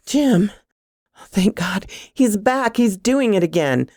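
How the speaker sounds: high, alarmed phone plea; panicked relief. A narrator's reading. Frightened woman reaching her boyfriend by phone, panicked.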